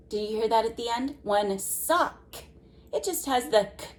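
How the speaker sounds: normal talking voice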